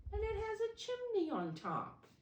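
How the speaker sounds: teacher wowing the class; amazed reveal. Acted. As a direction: marvel at the chimney. Teacher marvelling to children.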